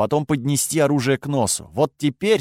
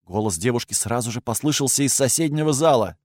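plain reading voice

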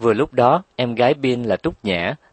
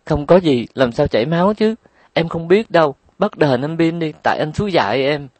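sniffling complaint; 7 semitones higher, 4 dB louder than usual. A narrator's reading. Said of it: "vẫn thúc thích".

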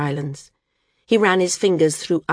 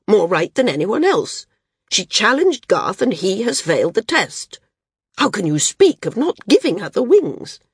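loud, raised, indignant outburst; furious; 5 semitones higher, 6 dB louder than usual. Acted. Sina protests to the judges, furious and loud. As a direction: demand answers, pitch climbing in outrage. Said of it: "She looked from judge to judge furiously".

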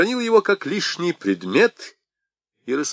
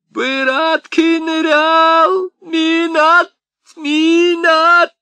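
high crying plea; pleading cry; 7 dB louder, much slower than usual. A narrator's reading. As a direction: wail it out desperately. Old man pleads desperately.

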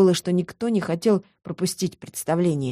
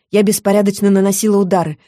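plain reading voice

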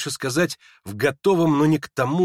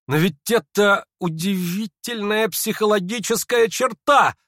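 slow, high, astonished exclamation; marvelling high-pitched outburst; crying out amazed; 9 semitones higher, 5 dB louder than usual. A narrator's reading. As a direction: exclaim it in sincere amazement, drawing it out. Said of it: "вскричал он вдруг".

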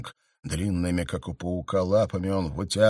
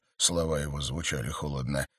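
ordinary delivery, nothing marked

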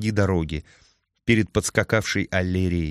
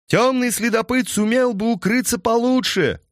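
shouting over wind; 14 semitones higher than usual. A narrator's reading. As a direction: yell back over the gallop. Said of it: "прокричала в ответ Аллерия".

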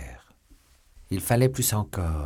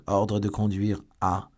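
slower official reading, steadier; rereading aloud; slower than usual. A narrator's reading. Lord de Winter rereading the order, slow and measured. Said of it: "Je reprends donc".

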